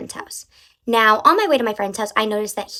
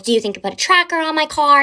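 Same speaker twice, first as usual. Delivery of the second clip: high, loud, worried question; anxious question; 6 semitones higher, 6 dB louder than usual. Retold or imitated. Shaken driver asking the cop about a tracker, anxious and loud. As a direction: plead with the officer for an answer.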